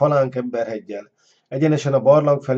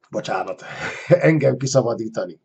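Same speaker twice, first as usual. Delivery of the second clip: laughing through the words; real laughing slip. Real, not acted. Narrator apologises after a slip, really laughing. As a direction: break into a laugh mid-reading.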